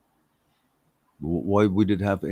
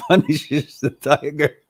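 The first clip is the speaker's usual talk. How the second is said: ordinary delivery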